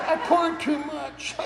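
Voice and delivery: high, slow sheepish imitation, laughing; wife's excuse. Husband imitating wife's excuse for pouring wine back, high and slow. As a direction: mimic her sheepish excuse.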